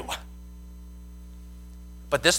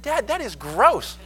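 higher, slow, mock-disgusted protest; a son recoiling from his dad's hug; grossed-out son; 8 semitones higher, 3 dB louder, slower than usual. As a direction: push dad away with a groan. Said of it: "I pushed back".

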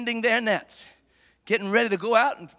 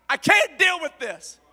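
higher-pitched exasperated protest; exasperated outburst; 6 semitones higher, 5 dB louder than usual. Acted. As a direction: act out Peter throwing in the towel. Preacher voices Peter giving up.

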